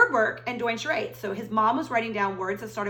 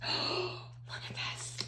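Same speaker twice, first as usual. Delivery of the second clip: suspense whisper; 10 dB quieter, much slower than usual. Acted. Suspense whisper over the page.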